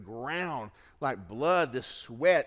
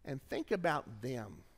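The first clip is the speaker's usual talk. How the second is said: normal talking voice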